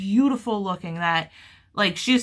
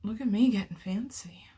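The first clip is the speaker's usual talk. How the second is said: whisper; 6 dB quieter than usual. Real, not acted. Aside while crocheting.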